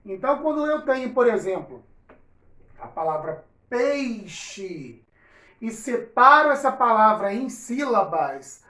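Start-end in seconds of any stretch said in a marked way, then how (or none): 3.7-5.0 peixe with a clear ei glide; slow careful peixe; with the diphthong. Shown as a demonstration. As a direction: say peixe as pei-xe, sounding the i. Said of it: "ao invés de escrever peixe".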